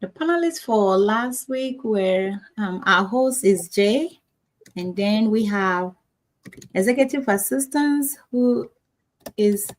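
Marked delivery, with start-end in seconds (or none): none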